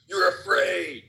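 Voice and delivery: half-shouted mock rant. Mimicking Olbermann's rant about the unvaccinated, mock shout. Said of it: "his little rant".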